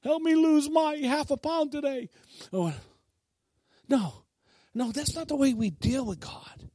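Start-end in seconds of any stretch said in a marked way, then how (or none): 0.0-2.1 mock pleading, rising higher; timid begging. Dieter begs God before weighing himself, mock-timidly. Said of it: "like, timid".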